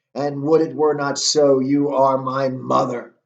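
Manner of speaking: loud acted bitterness; angry. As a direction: land the last accusation with angry force. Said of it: "the, the anger".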